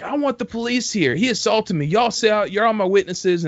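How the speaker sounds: high-pitched imitation of an irate yeller; mild mock yelling. Irate shooter to bystanders after a tussle, mock yelling. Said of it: "he's still yelling".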